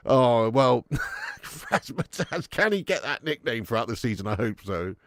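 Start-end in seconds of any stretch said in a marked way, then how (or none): 0.1-2.5 laughing. Real, not acted. Laughing at own nickname.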